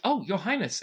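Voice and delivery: startled, deferential guard; flustered deference. Acted. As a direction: blurt it out to royalty, caught off guard. Guard startled by prince, bows.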